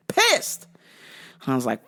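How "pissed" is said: much higher emphatic pissed; exasperated yelp. Real, not acted. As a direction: fling the word up high. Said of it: "I was pissed".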